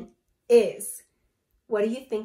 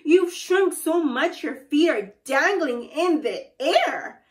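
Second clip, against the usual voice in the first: loud, higher taunting Mister Twit; crying out; 5 semitones higher, 7 dB louder than usual. A narrator's reading. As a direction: call it out with big punched words. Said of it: "he cried".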